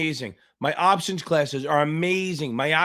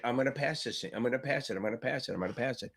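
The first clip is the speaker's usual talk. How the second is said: softer, inward self-talk repeated like a mantra; quiet mantra; 4 semitones lower, 11 dB quieter, faster than usual. Shown as a demonstration. Tutor demos the pass mantra for a nervous student, softer and inward. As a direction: murmur it to yourself like a promise. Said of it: "you gotta say to your shit".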